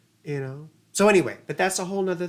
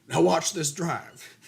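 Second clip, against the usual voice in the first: George W Bush quote; slower than usual. Retold or imitated. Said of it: "if anyone ever saw Fahrenheit nine eleven".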